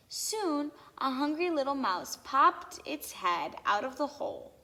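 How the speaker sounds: ordinary delivery, nothing marked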